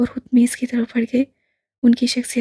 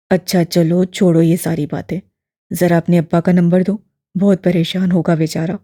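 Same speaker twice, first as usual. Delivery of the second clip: put-on low, louder elderly man, gently probing; lower, fuller old gentleman; old imam voice; 6 semitones lower, 5 dB louder than usual. A narrator's reading. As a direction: voice the old man, kindly and curious. Old man asks for father.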